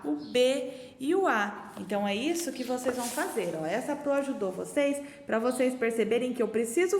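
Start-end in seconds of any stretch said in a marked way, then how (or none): 0.0-1.7 O B e o A spelled out slowly, letter by letter; name each letter slowly and clearly; slow letter spelling. Shown as a demonstration. Teacher slowly spelling out O B e o A for the class. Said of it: "Que que é o ba?".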